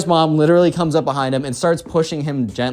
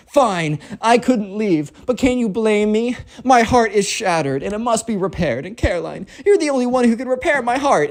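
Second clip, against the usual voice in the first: high, pleading lovesick declaration; melodramatic spoiled kid; 8 semitones higher than usual. Acted. Spoiled kid begs Caroline to mend his heart, melodramatic.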